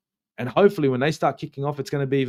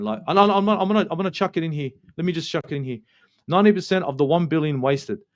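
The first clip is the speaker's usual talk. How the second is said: normal talking voice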